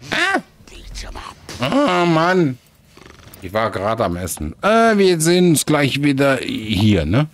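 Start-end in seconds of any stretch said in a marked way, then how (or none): 0.1-2.6 dismayed outcry. Real, not acted. Character dies in game.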